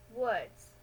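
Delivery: ordinary delivery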